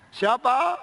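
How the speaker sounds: loud, high call to whoever knocked. Acted. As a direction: call "who's there" through a closed door. Calling out to a knock at door.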